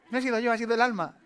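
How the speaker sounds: mock innocent, laughing; laughing excuse. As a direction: giggle out the excuse. Wife excusing herself laughing.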